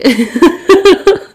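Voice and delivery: high burst of laughter; amused laugh. Real, not acted. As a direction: laugh at your own joke, high-pitched. Laughs at own imagined reply.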